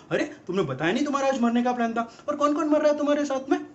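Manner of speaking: high-pitched imitation of an interrogating girlfriend; mock nagging girlfriend. Girlfriend grills her dying man about his plans, mock nagging. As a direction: pitch it up, pestering him with questions.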